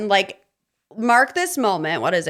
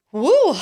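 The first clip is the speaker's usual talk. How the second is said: higher, louder cheer; excited whoop; 8 semitones higher, 6 dB louder than usual. Real, not acted. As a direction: whoop for the winners. Celebrating winners.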